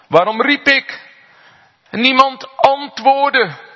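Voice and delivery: high, loud, slowing on antwoordde; loud lament. Acted. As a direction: cry out the complaint, drawn out. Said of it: "zo zegt de Heer". (luid en hoog klagend, vertraagd)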